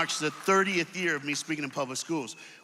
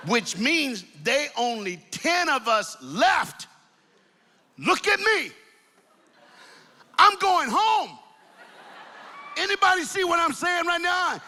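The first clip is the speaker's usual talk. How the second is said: preaching at full force; 10 semitones higher than usual. Acted. Preacher telling the crowd about Samson, fired up. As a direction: lift the whole room with it.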